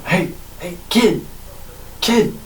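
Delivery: shouting after someone, rising; calling out. Acted. Calling out to the kid.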